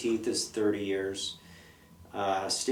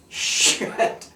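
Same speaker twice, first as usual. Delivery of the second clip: breathless whispered dismay; whispered curse; 4 dB louder than usual. Retold or imitated. Reacting to the noise with a whispered curse. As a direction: mouth it on a whisper. You're like.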